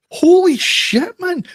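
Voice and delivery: very high, loud, drawn-out disbelief; astonished outburst. Retold or imitated. Pub-goer discovers his art teacher fronts a band, astonished outburst. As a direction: stretch it out in high-pitched amazement. Retelling shock at seeing teacher.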